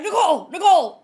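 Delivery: high, loud imitated shout; urgent waking call. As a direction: imitate a husband urgently shouting her awake. Husband urgently waking wife.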